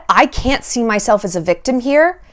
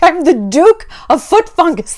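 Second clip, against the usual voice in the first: higher, hammed-up grand proclamation; mock nobility; 7 semitones higher than usual. Homeless king proclaiming another absurd title, higher mock-regal voice. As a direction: declare a silly title with pompous ceremony. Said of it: "He has to be, like, the king".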